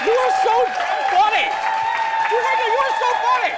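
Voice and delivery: high, loud, fast gushing delight; excited gushing. Real, not acted. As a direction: squeal it like a thrilled fan. Host gushes at guest.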